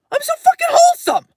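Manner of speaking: high, loud insistence; a loud, high protest that she is wholesome; emphatic claim. Real, not acted. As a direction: insist on it with a laugh in the voice. Emphatic self-description.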